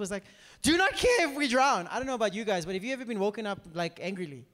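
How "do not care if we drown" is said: accusing shout. Acted. Disciples rudely wake Jesus.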